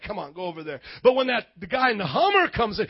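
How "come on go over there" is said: low, soft curt wave-off; dismissive brush-off. Retold or imitated. Salesman brushing off the smelly homeless guy, softly dismissive. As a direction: shoo him away under your breath. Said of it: "You're just like".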